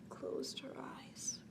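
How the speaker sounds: slow whisper. Acted. Lulling a child to sleep, slow whisper. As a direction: breathe it out, almost asleep.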